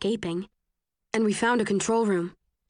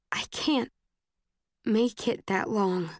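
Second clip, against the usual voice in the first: starting to cry; slower than usual. A narrator's reading. Exhausted girl telling her rescuer she can't last, tearful. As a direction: choke it out as tears begin. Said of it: "I choke on the words and start to cry".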